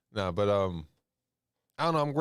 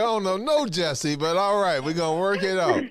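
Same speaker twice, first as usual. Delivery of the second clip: higher, louder, giggling aside; laughing through it; 8 semitones higher, 5 dB louder than usual. Retold or imitated. Host laughs about not knowing Jesse, higher, louder laughing aside. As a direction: laugh while playing along. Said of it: "was like".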